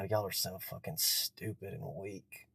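slow, quiet, contemptuous sneer; mock rich elite, slow and cold; sneering rich people. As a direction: mutter it down your nose at them.

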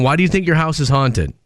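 usual speaking voice

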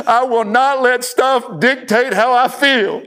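high, slow imitation of a screaming child; mock scream. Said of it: "Scream it, son".

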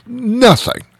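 NOTHING squeaked up very high; emphatic NOTHING. Real, not acted. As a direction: hit the word NOTHING high. Emphatic exclamation, very high pitch.

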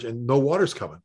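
normal talking voice

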